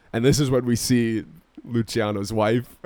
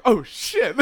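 high-pitched startled exclamation; mock shock; 9 semitones higher than usual. Cops seeing him have a heart attack, mock-shocked. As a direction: blurt it high, imitating alarmed cops. Retold cops' shock at collapse.

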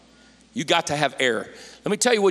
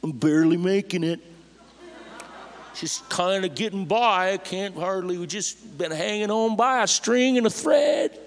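mock weary complaint; 6 semitones higher than usual. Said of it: "I didn't hear ... like".

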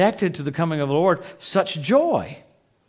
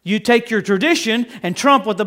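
loud, high revival preaching; preaching at full force; 7 semitones higher, 9 dB louder than usual. Acted. Preacher rebuking the church, loud and high. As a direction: thunder it at the congregation.

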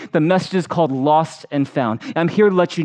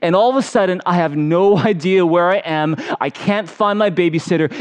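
ordinary delivery

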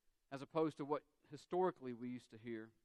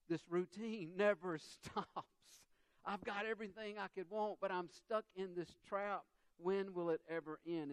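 higher-pitched, hammed-up exasperation; exasperated Solomon; 5 semitones higher than usual. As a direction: voice Solomon's despair at being trapped, pitched up. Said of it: "Solomon said, in, a, in essence".

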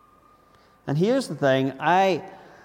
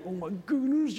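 higher, softer inner sigh; inward exasperation; 7 semitones higher, 6 dB quieter than usual. Retold or imitated. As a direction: despair quietly inside. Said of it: "inside you're going".

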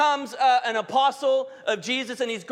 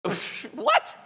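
half-playful disbelief, slowing down on all things work; sceptical scoff; 7 semitones lower, much slower than usual. Retold or imitated. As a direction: scoff at the verse as if it made no sense. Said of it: "I kinda go".

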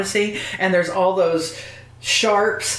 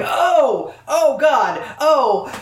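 mock-startled, higher exclamations; retold alarm; 6 semitones higher, much slower than usual. Moviegoer reliving the early scares, mock-startled. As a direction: act out dismayed gasps at a scare. Re-enacting reactions at scary moments.

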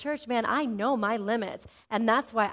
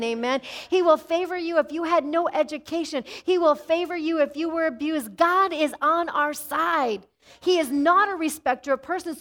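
higher, louder full-force preaching; 4 semitones higher, 4 dB louder than usual. Acted. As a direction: preach it to the church with fire.

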